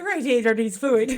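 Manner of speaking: a game stab at Irish on the spot; attempted Irish accent. Friend asks for Irish, she tries it laughing. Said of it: "give me something in Irish".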